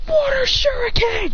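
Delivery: high, drawn-out play shout; attack call. Acted. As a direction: stretch the attack name out high as you throw. Fighter calls out his attack.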